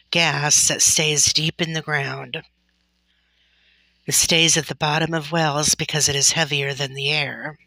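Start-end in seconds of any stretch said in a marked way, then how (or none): none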